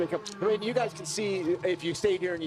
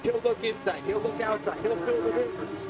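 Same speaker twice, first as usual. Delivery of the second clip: clipped, higher live race call; motorsport commentator; 5 semitones higher than usual. Real, not acted. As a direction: call each move of the chase.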